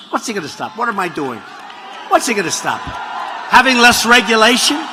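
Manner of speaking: usual speaking voice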